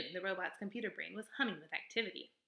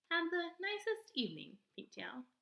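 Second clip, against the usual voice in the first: higher, even robot voice; 9 semitones higher than usual. Acted. Robot answering the possum in a higher, even voice. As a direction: answer plainly, like a machine.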